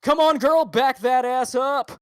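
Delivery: loud, slow, high anchor voice; newscaster dirty talk. Retold or imitated. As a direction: shout it like a sleazy news host. Continues dirty-talk newscaster bit.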